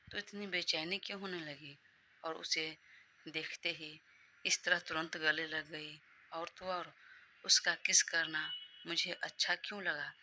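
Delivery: ordinary delivery, nothing marked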